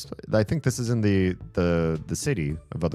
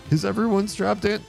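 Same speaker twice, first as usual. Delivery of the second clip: higher, bouncy clown voice; 7 semitones higher than usual. Acted. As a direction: play a cheerful clown. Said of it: "a clown voice or something".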